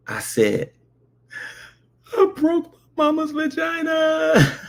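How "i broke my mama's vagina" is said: put-on high, drawn-out kid's wail; hammed-up slow wailing; mock child crying. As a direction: imitate a scared child's tearful confession. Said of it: "And I started crying".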